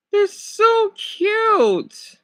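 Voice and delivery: high, slow, drawn-out coo. Real, not acted. Streamer melting over a dog photo, slow high cooing. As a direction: melt over the puppy picture.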